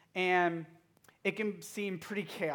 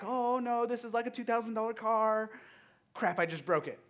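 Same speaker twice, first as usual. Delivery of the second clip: retold stressed dismay; 7 semitones higher, 4 dB louder than usual. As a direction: lament it loudly as if just realizing the damage. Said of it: "I was stressed".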